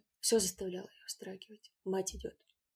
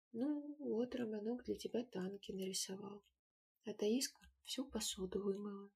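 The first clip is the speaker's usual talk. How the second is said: softer, timid explaining; shy explanation; 6 dB quieter than usual. A narrator's reading. Valentinka to mother, soft shy explanation. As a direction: explain the gifts shyly.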